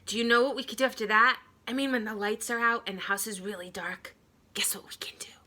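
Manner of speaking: fast, building to a whisper; excited then whispering. Acted. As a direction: drop to a secret whisper. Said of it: "he said".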